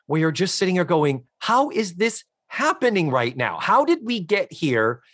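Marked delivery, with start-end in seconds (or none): 1.4-5.0 higher-pitched mock bewilderment; baffled disbelief. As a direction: ask it like a dumbfounded viewer. Acting out the baffled viewer.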